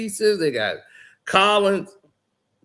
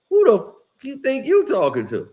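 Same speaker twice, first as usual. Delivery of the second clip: higher, mock-indignant challenge; retold angry comeback; 7 semitones higher, 3 dB louder than usual. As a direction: play the insulted veteran firing back. Player fires back at coach.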